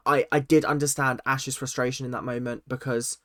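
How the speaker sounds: normal talking voice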